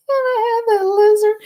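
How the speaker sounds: high excited squeal; high gleeful squeal. Real, not acted. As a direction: tell it with laughing triumph. Triumphant laughing retelling.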